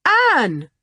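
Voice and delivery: a sharp, high call of alarm; alarmed yell. Acted. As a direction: cry her name out in sudden fear. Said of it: "yelled Julian in alarm".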